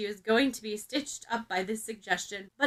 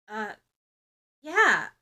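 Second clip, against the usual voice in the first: uncertain; 4 dB louder than usual. A narrator's reading. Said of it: "Ochako answers uncertainly".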